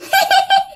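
very high eerie giggle; a spooky child's laugh; ghost laugh. Acted. Ghost girl laughing while the child screams, eerie giggle. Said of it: "bạn vẫn nghe tiếng cười của nó".

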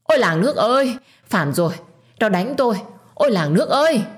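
high, loud theatrical wail to the village; wailing for help. A narrator's reading. Stepmother knocked down, wails to the whole village. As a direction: howl out like a public lament. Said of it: "Bà ta tiếp tục gào lên".

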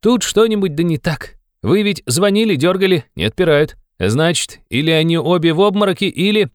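high, excited youthful warning; urgent young man. A narrator's reading. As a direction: reason urgently, voice pitched high. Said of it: "закричал опять молодой человек". (взволнованный высокий молодой голос)

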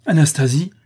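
sudden startled outburst; alarmed cry. A narrator's reading. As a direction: cry out a loved one's name in alarm. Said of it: "s'écria Alfred".

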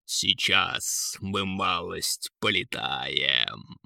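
slow, low, ominous eagle; eagle's voice. A narrator's reading. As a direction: draw it out deep as a promise. Eagle warns the kings. (низко и медленно, голосом орла)

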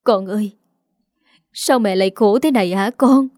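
put-on halting, with a long pause after calling her child; mild, hesitant mother's lament. A narrator's reading. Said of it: "bà Kiên mới ngập ngừng lên tiếng".

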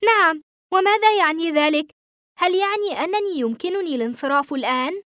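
high, excited cry of joy; overjoyed and eager, voice lifted; happy shout. A narrator's reading. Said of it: "صرخت في سعادة".

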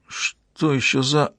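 slow, startled mumble, trailing unfinished; drawn-out, mildly scared murmur; frightened mutter. A narrator's reading. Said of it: "пробормотала она испуганно".

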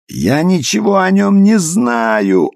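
high, drawn-out desperate yell. A narrator's reading. Luis cursing at his torturers, yelling. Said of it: "орал и грязно ругался Луис".

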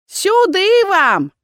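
high, loud, slow crone call. A narrator's reading. Crone ushering visitors inside, high and loud. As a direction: drawl it like a village hag.

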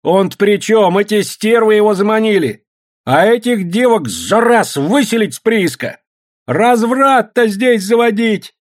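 high, loud, drawn-out outrage; whole crowd of women clamouring; women yelling. A narrator's reading. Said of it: "орали бабы".

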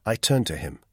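usual speaking voice

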